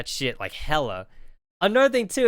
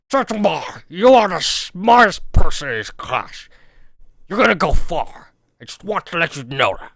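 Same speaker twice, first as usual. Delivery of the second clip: louder hoarse teacher voice; imitating Doctor Humphrey; 6 dB louder than usual. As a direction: praise him in a croaky old voice. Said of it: "Doctor Humphrey was all like".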